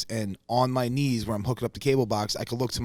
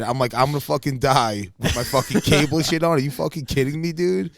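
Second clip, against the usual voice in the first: louder, rising disbelief; building outrage, higher by the end; freaking out; 4 semitones higher, 7 dB louder than usual. Retold or imitated. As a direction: wound up at the thought of dying. Retelling fear of dying.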